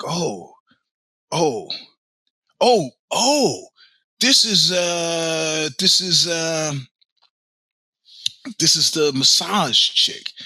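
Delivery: slow, rising groggy realisation; dawning realisation. Retold or imitated. Just woken on the beach, realising it's the masseuse, dazed. Waking to realise who she is.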